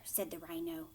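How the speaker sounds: ordinary delivery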